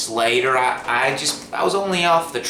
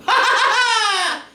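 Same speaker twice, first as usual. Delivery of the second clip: high burst of laughter; giddy laugh at the potato; delighted laugh; 6 dB louder than usual. Real, not acted. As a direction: crack up at his own trick. Reacts to his "magic" trick.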